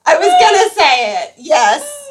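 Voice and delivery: loud, laughing and delighted; laughing excitedly. Real, not acted. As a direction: shout it through laughter.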